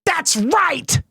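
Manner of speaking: high shouted echo of the clip; mock bowler yell. Streamer shouting along with the clip, excited. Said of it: "echoing the bowling clip".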